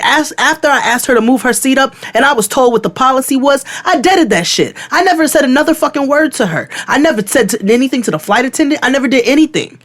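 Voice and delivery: high, heated self-defence; worked-up, high-pitched retold rant; indignant retold defence. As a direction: re-enact protesting your innocence. Acted indignant self-defence to agents.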